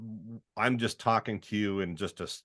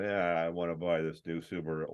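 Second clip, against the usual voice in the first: lazy, softer couch-potato read; laid-back ad read; 4 semitones lower, 5 dB quieter than usual. Retold or imitated. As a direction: mumble it like you're lying on the couch. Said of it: "lying on the couch going".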